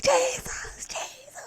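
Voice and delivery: high-pitched mock wail. Calling on Jesus in jail, crying out. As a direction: re-enact wailing out the name. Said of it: "I got louder and louder".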